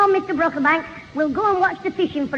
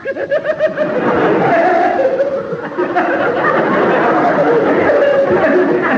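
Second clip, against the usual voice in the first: a long, real, delighted laugh; long hearty laugh; 6 semitones higher, 3 dB louder than usual. Real, not acted. Boy laughing at his joke about the worm, hearty laugh. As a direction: laugh out loud at your own joke.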